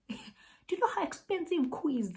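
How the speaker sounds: high, fast outraged question; indignant disbelief. Real, not acted. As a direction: demand it, incredulous and high. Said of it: "indignant rant".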